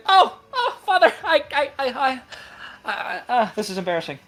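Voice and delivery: very high, stammering, falling as she gives up; a mortified girl tripping over her words; flustered daughter. Acted. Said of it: "I'm gonna try that again".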